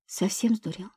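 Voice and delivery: soft, hushed disbelief; quiet question. A narrator's reading. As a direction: ask it under your breath. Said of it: "уточнила тихо".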